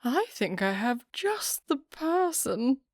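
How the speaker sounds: high, slow and eager lady's voice; excited. A narrator's reading. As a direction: gush it out, thrilled with her idea. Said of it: "with so much excitement".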